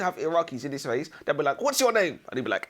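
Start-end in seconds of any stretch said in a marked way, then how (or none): 1.6-2.2 interrogator. Acted. Acted Iraqi interrogator questioning.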